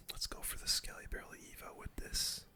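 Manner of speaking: ordinary talk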